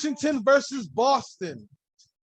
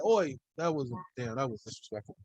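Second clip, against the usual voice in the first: mock Aussie; 8 semitones lower, 7 dB quieter than usual. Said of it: "Why do I have to curse when I do an accent?".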